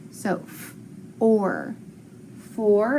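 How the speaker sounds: "f", "or", then "four", with OR said as one sound; teacher blending the sounds for the class. Shown as a demonstration. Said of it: "arch 'em together".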